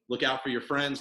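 ordinary delivery

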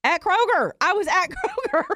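exasperated exclaim. Retold or imitated. Exasperated punchline about store.

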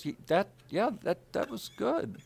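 high, halting, dumbfounded voice; stunned approval. Retold or imitated. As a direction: stammer it out, imitating stunned disbelief. Stunned man reacts to the take.

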